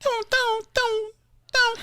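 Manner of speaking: high squawking magpie call; chattering magpie voice. Acted. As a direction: caw it out like a magpie. [name] does magpie call.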